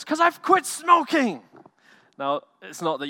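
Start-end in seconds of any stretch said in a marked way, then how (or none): 0.0-1.5 high, loud and slow, beaming announcement; imitating a friend proudly bursting with the news; proud and thrilled. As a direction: announce the victory like a kid with big news. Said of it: "He goes".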